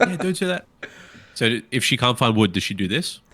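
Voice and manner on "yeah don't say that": alarmed warning. Retold or imitated. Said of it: "echoing the alarmed quote".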